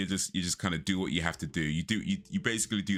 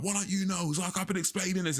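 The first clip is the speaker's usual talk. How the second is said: mock shouting; 13 semitones higher than usual. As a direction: imitate an irate customer up high. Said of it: "been shouting at me".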